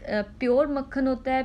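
normal talking voice

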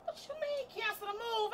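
normal talking voice

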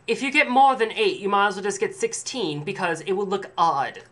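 high, loud snooty clerk; snobby saleswoman. Retold or imitated. Snobby saleswoman telling a customer to buy sixteen. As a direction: put on a snobby shop-woman act. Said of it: "the snobby woman, I wish I had a wig or something to demonstrate that".

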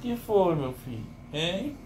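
high, cooing pet talk. Real, not acted. Owner cooing to his whining dog in baby talk.

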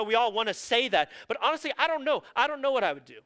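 ordinary talk

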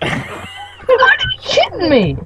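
very high, slow disbelieving cry; disbelieving shout. Real, not acted. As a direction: shout it in mock outrage. Sudden outburst after laughter.

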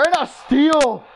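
high, drawn-out burst of excitement; excited call. Real, not acted. Commentator announces a steal to viewers, excited. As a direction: pounce on the sudden steal.

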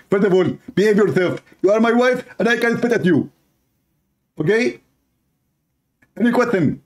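high, haughty husband voice; bossy husband. Acted. Husband scolds wife in skit.

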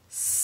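STAR with a very long hissed S before the rest; long snake sound. Shown as a demonstration. Teacher tries a new word with children, long S. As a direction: hold the S at the front of star. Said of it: "Let's try that word".

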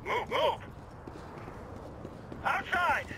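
pushing battlefield command; urgent combat order. Acted. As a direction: hurry the squad out. Urgent orders while clearing.

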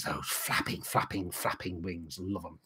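hushed, low breathy voice; whispering. Pretend. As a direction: whisper it like a secret. Hushed wing description.

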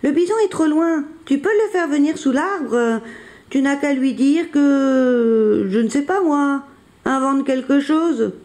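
high-pitched calling with little extra volume. A narrator's reading. Billy instructs Jean-Claude from the tree, calling out. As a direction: call down to a friend, stretching some words. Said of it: "crie-t-il".

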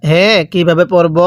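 usual speaking voice

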